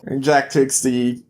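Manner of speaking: ordinary delivery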